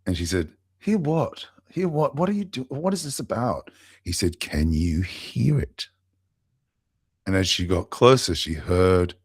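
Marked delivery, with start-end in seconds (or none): 0.8-3.7 higher-pitched, baffled retold questions; bewildered questioning. Retold mother puzzled, impatient questioning. 4.4-5.9 slowly spaced retold question; slow, deliberate question. Retold man repeats his question deliberately.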